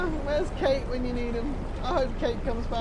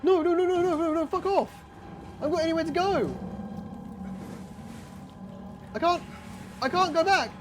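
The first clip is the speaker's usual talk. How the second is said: panicked pleading; 7 semitones higher, faster than usual. Real, not acted. Cornered by the monster, panicking.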